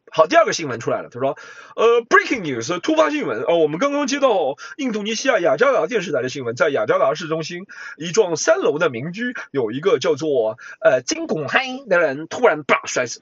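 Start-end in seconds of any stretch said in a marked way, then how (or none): 1.8-13.2 breaking news. Pretend. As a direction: announce it like a breaking bulletin. Second mock bulletin. (模仿突发新闻播报)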